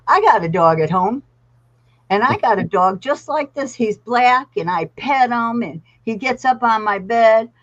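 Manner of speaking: put-on boy bragging to the handler about his dog at home; excited little boy. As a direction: let it tumble out like an excited child.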